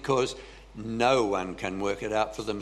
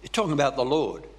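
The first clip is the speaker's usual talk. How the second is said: ordinary talk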